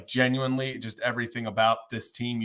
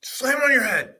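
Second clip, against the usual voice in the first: excited; 11 semitones higher than usual. Real, not acted. Cheering co-host after chug.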